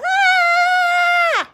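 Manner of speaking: high-pitched scream. Shown as a demonstration. Teacher showing the scream that won't release emotions. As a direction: let out a sharp adrenaline scream. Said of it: "This is not gonna".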